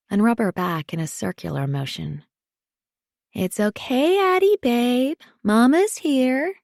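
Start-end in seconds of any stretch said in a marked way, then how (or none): 3.3-6.5 high, sing-song mama voice; soothing baby talk. A narrator's reading. Mother soothing her squirming daughter, baby-talk voice. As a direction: coo high to settle a baby, slowing at the end. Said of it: "I continue in my regular voice".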